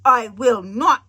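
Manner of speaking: slow, firm and loud refusal; a touch of bravery. Acted. Lion refusing the witch, slow firm refusal. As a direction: plant your feet and refuse. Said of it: "said it in a very brave way".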